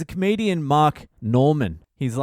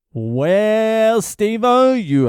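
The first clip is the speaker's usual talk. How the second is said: big hearty greeting; 9 semitones higher, 7 dB louder, much slower than usual. Acted. Host greets listener's question with gusto.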